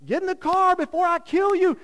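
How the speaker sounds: loud, high mock yell; mock angry parent. Parent yelling at kids before church, loud mock yell. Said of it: "we're all like".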